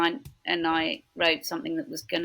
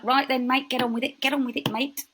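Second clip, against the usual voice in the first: higher, brisk Cockney chivvying; no-nonsense Cockney grandmother, raised pitch; Cockney nan; 6 semitones higher than usual. Retold or imitated. Cockney nan telling granddaughter to carry on, brisk and higher. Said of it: "my Cockney nan".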